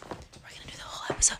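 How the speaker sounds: hushed whisper into the mic; ASMR whisper. Shown as a demonstration. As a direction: whisper close and soft. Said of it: "just like this".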